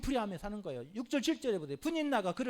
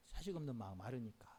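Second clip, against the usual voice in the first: 자식 없는 마음 아르니까 repeated much softer and lower; soft echo; 11 semitones lower, 11 dB quieter than usual. Real, not acted. Preacher repeats the reason to the congregation, quietly. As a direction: let it sink in quietly.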